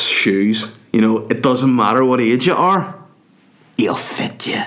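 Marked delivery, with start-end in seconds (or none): 3.8-4.6 hushed confiding whisper. Acted. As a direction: lean in and whisper it. Secret aside to audience.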